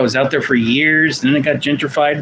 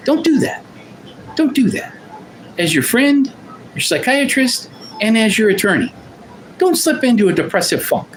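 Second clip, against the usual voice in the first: higher-pitched, insistent plea in short separate phrases; earnest warning, pitched up; emphatic urging; 6 semitones higher than usual. Real, not acted. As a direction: urge them firmly, pausing between each phrase. Urging viewers against depression.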